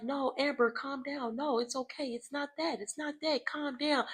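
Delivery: lightly voiced Natalie calming Amber; imitating soothing friend. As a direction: settle her down gently, imitating.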